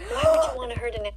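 sharp high gasp of horror; shocked gasp. Real, not acted. A kid hearing about eating dogs, sharp shocked gasp. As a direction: suck in air, appalled.

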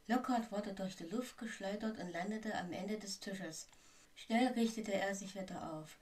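usual speaking voice

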